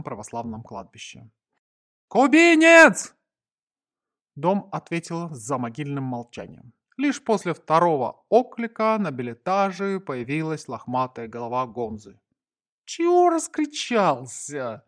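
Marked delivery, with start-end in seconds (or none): 2.1-3.1 high, drawn-out holler; full-house shout. A narrator's reading. Narrator hollers for the Cuban on arriving home, loud drawn-out shout. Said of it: "прокричал на весь дом". 12.8-14.8 high, slow grumbling complaint; complain, put out by the noise; disgruntled grumble. A narrator's reading. Gonza to his noisy friend, high disgruntled grumble. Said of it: "недовольно пробурчал он".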